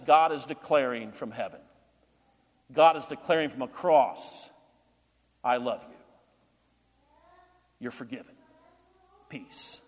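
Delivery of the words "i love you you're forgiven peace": tender fading voice. Acted. God speaking tenderly from cross.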